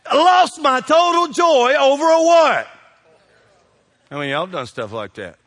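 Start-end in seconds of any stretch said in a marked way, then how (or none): 0.1-2.7 preaching shout. Real, not acted. Preacher's loud rhetorical climax.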